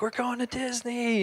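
high, soft thrilled reveal; excited announcement. Retold or imitated. Parents revealing the Disney trip, high and thrilled. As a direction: announce the surprise to the kids. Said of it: "and we're like".

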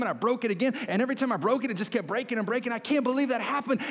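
breathless, high-pitched disciple gushing to friends; acted-out giddy excitement, high and rushing; excited retelling. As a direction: tumble through the story, amazed and thrilled. Excited disciples recounting the miracle.

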